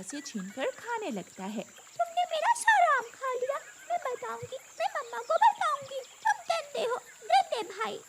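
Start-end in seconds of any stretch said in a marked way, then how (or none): 1.6-8.0 very high, whiny little-bird voice; tearful whining. Acted. Little sister crying over her eaten mango, tearful whining. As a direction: wail like a wronged little sister. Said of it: "कैसे रो रही है".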